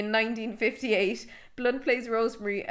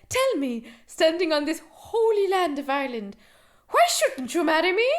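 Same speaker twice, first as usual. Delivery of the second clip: hammed-up stage Irish, pitched higher and climbing; mock Irish accent; 6 semitones higher than usual. Mimicking Emily Blunt's film line, in a mock Irish accent. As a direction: ham up a stage-Irish proposal, rising to the question. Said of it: "remember Emily Blunt said this line".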